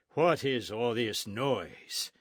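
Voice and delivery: put-on slow, weighty demand from an old friar; slow booming friar's challenge; deep demanding friar. A narrator's reading. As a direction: ask it like a giant of a man in charge. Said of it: "he demanded in his deep voice".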